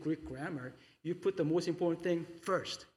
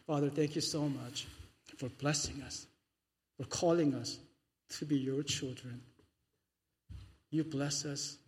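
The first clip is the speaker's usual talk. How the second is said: prayer. Acted. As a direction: pray gently to the Father. Pastor leads closing prayer.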